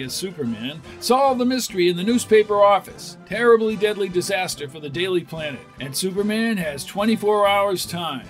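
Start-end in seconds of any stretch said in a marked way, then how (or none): none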